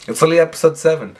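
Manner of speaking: normal talking voice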